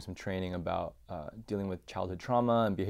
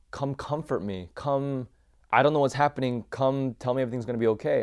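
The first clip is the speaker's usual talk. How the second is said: higher, louder pleading for comfort; re-enacted child's plea, pitched up and building louder; retold crying out; 5 semitones higher, 7 dB louder than usual. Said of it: "just start crying out for my mom".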